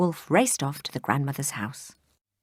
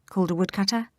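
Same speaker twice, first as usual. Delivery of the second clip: plain reading voice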